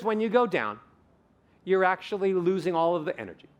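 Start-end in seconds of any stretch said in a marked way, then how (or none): none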